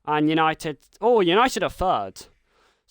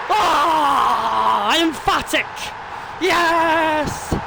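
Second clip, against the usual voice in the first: loud, higher roar of victory; triumphant shout; 11 semitones higher, 5 dB louder, much slower than usual. Acted. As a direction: celebrate the winner at full voice. Winning penalty scored.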